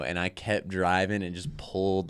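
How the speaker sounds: ordinary talk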